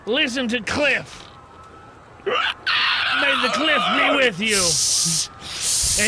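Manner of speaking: preachy slow shout. Acted. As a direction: proclaim it to the crowd. Addresses the train passengers.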